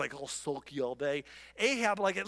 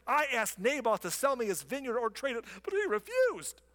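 whiny Ahab; 6 semitones higher than usual. Acted. Ahab whining to his wife.

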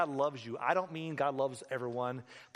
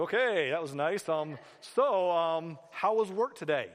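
raised, loud voice, drawn-out awkward politeness; awkwardly polite wife; 3 semitones higher, 5 dB louder, slower than usual. Acted. Wife trying to start small talk after a recitation, awkwardly polite. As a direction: humor him, then change the subject.

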